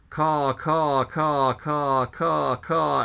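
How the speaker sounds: a mild, lightly voiced crow caw. Pip plays a crow.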